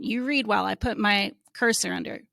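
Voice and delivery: ordinary talk, nothing marked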